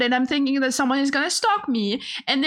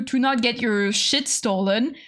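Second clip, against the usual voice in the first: ordinary talk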